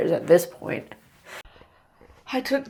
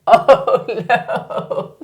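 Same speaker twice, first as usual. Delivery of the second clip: laughing; 5 semitones higher, 6 dB louder than usual. Real, not acted. Laughing at the snot.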